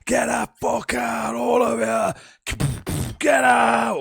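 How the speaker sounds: high, drawn-out furious shout; enraged housemate shouting. Retold or imitated. Housemate kicking his door at party guests, shouting furiously. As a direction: yell at them all to get out. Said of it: "my housemate started kicking his door".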